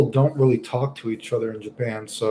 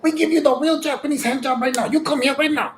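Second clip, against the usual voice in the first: high mock grandma with broken English; much higher imitation of a pushy street grandma; 13 semitones higher than usual. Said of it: "the grandma's like".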